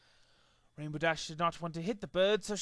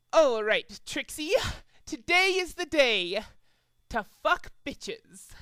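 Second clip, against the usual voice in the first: cocky self pep talk; 7 semitones higher, 4 dB louder, slower than usual. Acted. Trixie pep-talking herself.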